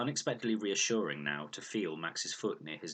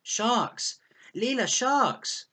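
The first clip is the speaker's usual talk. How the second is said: high, very loud scream, drawn out; child shrieking sharks in the rain; screamed warning; 11 dB louder, much slower than usual. A narrator's reading. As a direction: scream it in panic, slowly. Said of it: "he let out a scream".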